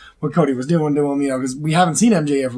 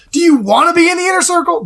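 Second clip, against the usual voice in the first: lightly voiced Jericho, raised high and louder; mock Chris Jericho; 13 semitones higher, 4 dB louder than usual. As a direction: tease him like a smug Jericho. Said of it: "And Chris Jericho goes".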